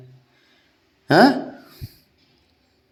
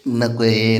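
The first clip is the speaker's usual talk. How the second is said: laughing; much slower than usual. Real, not acted. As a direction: laugh while waving the pet off. Laughing at pet.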